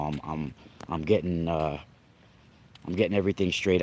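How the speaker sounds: ordinary delivery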